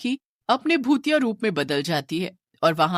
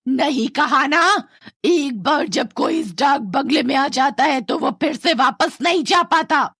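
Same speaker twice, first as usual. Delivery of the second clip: loom over them and forbid them to leave; menacing old ghost; 7 semitones higher, 5 dB louder than usual. Acted. Old ghost woman at the door refusing to let the family go, menacing.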